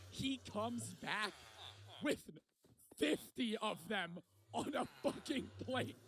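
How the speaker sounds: slow, halting words on the edge of laughing; barely holding laughter. Real, not acted. Telling of the plate coming back, about to crack up. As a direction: drag it out as the laugh builds. Breaking up at own story.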